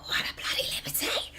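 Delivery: hushed mock-indignant whisper; whispered pretend neighbour's scorn. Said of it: "she's like".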